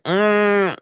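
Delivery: tight-lipped. Retold or imitated. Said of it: "Tight-lipped teeth".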